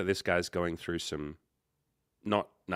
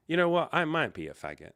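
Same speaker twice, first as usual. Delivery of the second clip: blurted reply; 9 semitones higher, 4 dB louder than usual. Retold or imitated. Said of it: "the words in my head popped".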